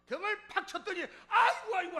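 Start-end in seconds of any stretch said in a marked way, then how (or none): 1.3-2.0 loud, high "아이고" cries through laughter; mock pained outcry, laughing; startled yelp. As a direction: yelp as if slapped hard on the back, laughing. Deacon yelps after back slap.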